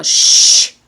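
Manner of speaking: a long unvoiced shh; shushing. Shown as a demonstration. Vlogger tells his racing mind to hush. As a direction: hush the thoughts firmly. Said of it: "I often tell myself".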